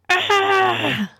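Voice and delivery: high-pitched startled yell. A narrator's reading. Ibrahim on seeing the rats, yelling. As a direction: let out a high scream of fright. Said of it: "he yelled".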